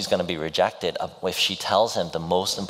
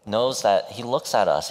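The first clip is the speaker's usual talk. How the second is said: usual speaking voice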